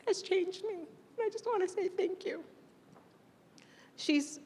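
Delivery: high, soft, breaking voice; tearful. Real, not acted. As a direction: barely get the thanks out. Emotional thanks to student.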